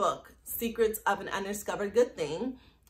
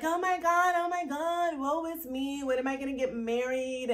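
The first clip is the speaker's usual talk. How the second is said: mock lovesick girl; 3 semitones higher, 4 dB quieter than usual. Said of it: "one of those girls that were like".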